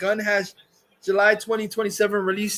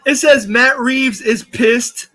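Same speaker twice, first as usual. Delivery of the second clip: laughing taunt; 2 semitones higher, 6 dB louder than usual. Real, not acted. Laughing rant at Matt Reeves.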